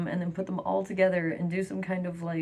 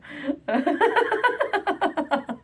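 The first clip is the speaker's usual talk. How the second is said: loud laugh; 11 semitones higher, 5 dB louder than usual. Real, not acted. Big laugh after croissant joke.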